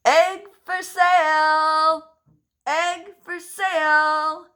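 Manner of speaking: slow, high, loud market call; drawn-out loud egg for sale; shouting. Shown as a demonstration. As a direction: shout it to the whole town. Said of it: "this time we're shouting".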